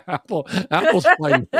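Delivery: ordinary delivery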